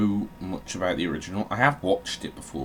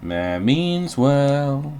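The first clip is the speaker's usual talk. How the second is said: drawn-out name; 6 semitones higher, 7 dB louder, much slower than usual. Pretend. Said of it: "the name Mayor Meanswell is the funniest".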